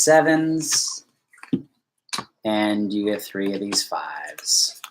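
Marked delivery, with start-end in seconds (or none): 2.4-4.8 whisper. Real, not acted. Whispered aside while dealing cards.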